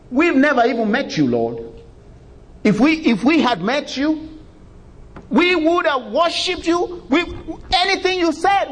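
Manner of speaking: high, whiny plea of the condemned, stretched out; whining protesting sinners. Retold or imitated. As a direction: beg in a high, put-upon whine, pretending innocence. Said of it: "those other people too, will say the same thing".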